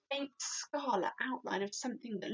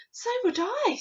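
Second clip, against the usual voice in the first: put-on higher child agreeing; a little girl agrees; girl's voice; 12 semitones higher, 5 dB louder than usual. A narrator's reading. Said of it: "Sophie said".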